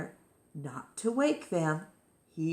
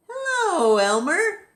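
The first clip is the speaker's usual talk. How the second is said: bright, high, louder hello to his reflection; cheery greeting; 12 semitones higher, 7 dB louder than usual. Acted. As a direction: greet yourself with delight. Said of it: "he said to himself".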